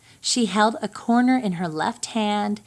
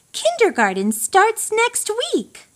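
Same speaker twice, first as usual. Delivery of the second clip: bright mother voice; 11 semitones higher than usual. Acted. Mother announces school.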